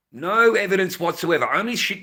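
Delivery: loud, heated burst of anger; angry outburst. Real, not acted. Father ranting to viewers about the report, loud and angry. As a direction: let the fury spill out loud.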